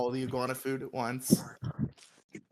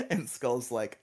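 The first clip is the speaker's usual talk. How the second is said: ordinary talk, nothing marked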